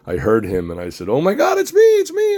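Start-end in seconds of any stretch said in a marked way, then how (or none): 1.1-2.4 much higher, louder giddy fan squeal; thrilled squeal. Retold or imitated. Fan squealing to Cary about directing the show. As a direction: squeal like a five-year-old fan.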